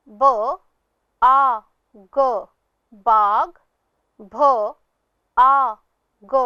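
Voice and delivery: ब आ ग, भ आ ग, each sound apart and strong; spelled sound by sound, loud. Shown as a demonstration. Teacher to pupils, contrasting बाग and भाग sound by sound. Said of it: "अब अगला शब्द है".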